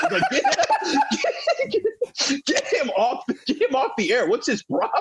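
high, giggling mock complaint; laughing through it. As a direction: laugh as you mock the rant. Laughing while mocking dad's rant.

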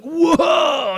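high, loud mock gangster holler; startling shout. Gangster jumps out of bushes at the pastor, startling shout. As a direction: leap out at someone with a big yell.

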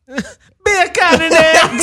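slow, high, laughing Jamaican-ish accent. Shown as a demonstration. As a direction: laugh through a Jamaican lilt. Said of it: "You sound Jamaican".

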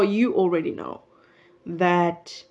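ordinary talk, nothing marked

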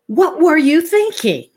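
high, loud mock scolding; exasperated parent question. Parent to teenager who got a ticket, loud exasperated question. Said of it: "What was the question you asked?".

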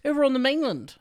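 high, loud mock-Australian accent; mocked Australian manager. Worker mimicking his Australian manager on Matalan, mocking Australian voice. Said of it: "is the way he said it".

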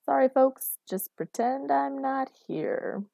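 high, singsong apology; conciliatory. A narrator's reading. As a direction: back off lightly, drawing out the words. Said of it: "raised her hands up conciliatorily".

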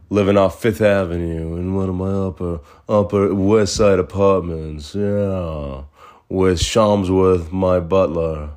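lazy, self-satisfied New Yorker putting on airs; a smug New York drawl, slowed down on the butler's name. Pretend. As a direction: drawl it like a rich Upper West Sider showing off. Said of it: "my smug New York voice".

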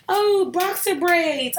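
slow, high, mock airy voice; mocking valley girl. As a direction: drawl it like a clueless girl.

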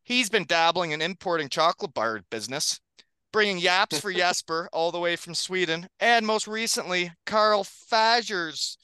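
big hype podcast announcer read; announcer intro. Acted. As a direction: introduce the guests like a showman. Host reads hype podcast intro.

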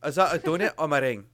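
put-on quick, light Glaswegian accent; Glasgow accent. Retelling the bakery joke, quick Glaswegian accent. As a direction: Glasgow lilt, rattled off quickly. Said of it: "I'll do it again".

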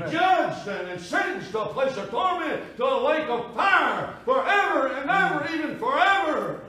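loud, high sustained preacher's cry; full-force pulpit proclamation; impassioned preaching. Real, not acted. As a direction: thunder the warning of judgment, drawing out each phrase. Preacher proclaiming eternal judgment.

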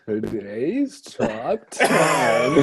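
drawn-out, loud countdown announcer parody; hammed-up top ten list intro, stretched very slow; mock YouTuber intro. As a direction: stretch it out like a cheesy countdown host. Imitating the YouTuber Chills.

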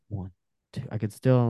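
ordinary talk

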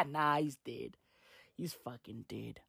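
ordinary talk, nothing marked

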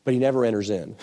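ordinary talk

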